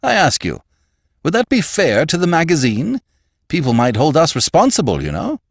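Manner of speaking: high, loud, worried plea; distressed appeal. A narrator's reading. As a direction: appeal anxiously, pitched well up.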